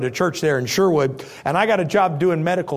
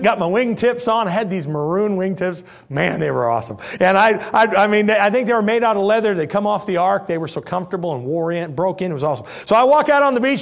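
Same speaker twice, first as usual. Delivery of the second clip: usual speaking voice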